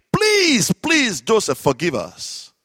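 high begging 'please' that drops and softens at the end; acted pleading. Frightened brothers to Joseph, begging forgiveness. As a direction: beg for mercy, starting high and easing down. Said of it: "We're gonna beg him".

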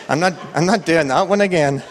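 amused, laughing protest. Real, not acted. Joking with the congregation after the quiz, laughing. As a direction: say it through a light laugh.

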